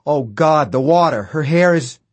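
alarmed realization. A narrator's reading. Man seeing her hair in the water, in loud alarm. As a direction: break out of the daze in rising alarm.

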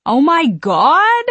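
high, loud, drawn-out exclamation; tipsy man's squealed surprise; startled delight. A narrator's reading. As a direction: balk in giddy disbelief. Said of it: "the middle one balks".

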